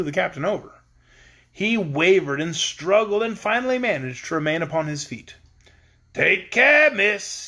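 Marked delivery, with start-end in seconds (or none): 6.1-7.5 raised, loud caution; warningly. A narrator's reading. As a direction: warn her off. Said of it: "he said warningly".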